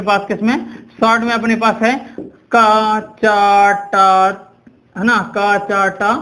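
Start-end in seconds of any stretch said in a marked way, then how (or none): 2.5-4.1 each syllable held long for learners to memorise; drawn-out recitation. Shown as a demonstration. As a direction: stretch का, चा, टा slowly, syllable by syllable. Slowly recites memory trick syllables.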